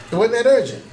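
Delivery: mock offhand caller, high and loud; dismissive caller. Pastor voicing a member who left no message, shrugging it off. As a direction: toss it off like it never mattered.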